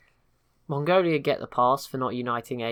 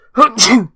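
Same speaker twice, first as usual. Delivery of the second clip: sudden loud sneeze; 12 semitones higher, 19 dB louder than usual. Real, not acted. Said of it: "Sorry, good old sneeze".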